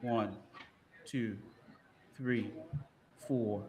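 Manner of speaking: soft counting, fading to a whisper; quiet countdown. Real, not acted. Quizmaster timing the contestant, quiet countdown. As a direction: count down under your breath.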